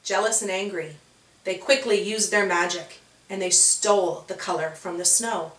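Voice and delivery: ordinary delivery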